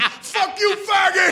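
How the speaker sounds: high-pitched mock heckler yell; retold heckle shouted high; screaming hecklers. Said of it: "twenty-four kids from Southern California screaming".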